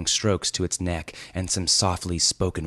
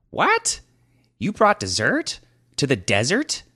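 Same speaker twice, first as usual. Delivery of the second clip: very high, loud incredulous squawk; outraged disbelief; 9 semitones higher, 4 dB louder than usual. Acted. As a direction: blurt out stunned outrage. Thief outraged at tiramisu.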